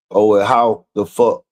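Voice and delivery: usual speaking voice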